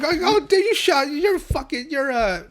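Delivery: very high, loud, slow panic; panicked boy. Retold or imitated. Boy panicking about the fire, very high and loud. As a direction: stammer out in alarm. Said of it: "And he's like".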